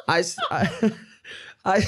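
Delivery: laughing. Real, not acted. Laughing at own story.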